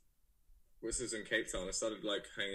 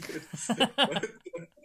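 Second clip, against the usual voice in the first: bright burst of laughter, high and loud; real laugh; 13 semitones higher, 12 dB louder than usual. Real, not acted. Guest laughing about offending Dalston listeners, loud high laugh. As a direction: laugh out at your own cheeky remark.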